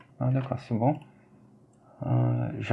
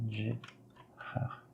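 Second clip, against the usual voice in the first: Gérard whispered, much softer, no voice; 14 dB quieter than usual. Shown as a demonstration. Teacher whispers the name to herself, whispered. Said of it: "G comme".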